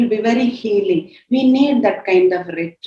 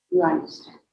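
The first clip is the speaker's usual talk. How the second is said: whispered aside; 9 dB quieter than usual. Real, not acted. Whispered repeat to congregation.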